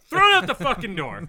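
loud mock-outraged shout. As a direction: yell it in fake outrage.

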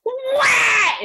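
very high, loud shout; imitating the girl. The classmate shouts in disbelief. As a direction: shriek the single word high. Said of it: "And she goes".

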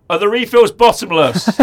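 loud, slow, high-pitched mock yell. Giving up on the puzzled cafe lady, loud slow yell. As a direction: belt the question out slowly to get understood. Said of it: "you need to be louder".

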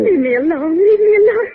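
frightened plea. Acted. Terrified girl threatened by thugs.

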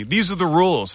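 high-pitched mild mock yell; retold yelling. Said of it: "Physician's assistant yelling at me".

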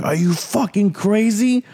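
high, slow, drawn-out disbelief; shocked disbelief. Retold or imitated. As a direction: ask it like he just heard something insane. Coworker shocked he ate three.

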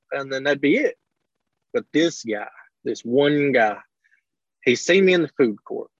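usual speaking voice

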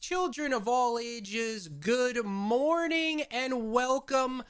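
booming ringmaster announcement; slow, drawn-out ringmaster welcome. Pretend. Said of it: "circus-style opening announcement".

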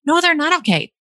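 high, insistent correction; mock protest. Arguing back at parents' soothing.

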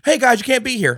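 high, loud, silly guard voice; mock guard voice. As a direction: ham up a meek guard laughing. Mocking armored truck guard.

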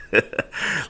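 breathy laugh. Real, not acted. Laugh after rating request.

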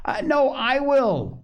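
put-on high, drawn-out boyish call; shouting boy. A narrator's reading. Joel racing after his brother to do it first, shouting. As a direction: call out slowly, pitched far up. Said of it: "shouted Joel".

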